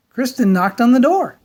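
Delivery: usual speaking voice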